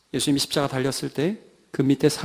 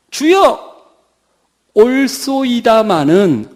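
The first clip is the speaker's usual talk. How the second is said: declaimed reply; 4 semitones higher, 7 dB louder, much slower than usual. Acted. Acts out the woman's reply.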